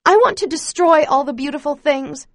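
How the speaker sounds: loud angry outburst. A narrator's reading. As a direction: spit it out in rage.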